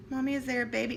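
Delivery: a hint of a curious child; child's voice. Son asking about a baby again, lightly voiced child.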